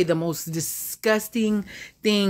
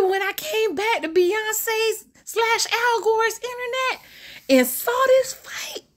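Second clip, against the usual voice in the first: dramatic; slower than usual. Real, not acted. Dramatic build to the fight.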